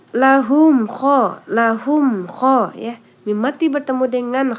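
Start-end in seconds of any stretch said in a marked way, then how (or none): none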